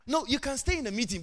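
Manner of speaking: ordinary talk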